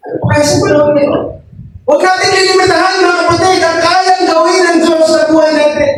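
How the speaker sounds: impassioned, high-pitched preacher's exhortation; fervent preaching. Real, not acted. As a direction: drive each phrase home, pitched high. (masidhing pangangaral, mataas ang tono)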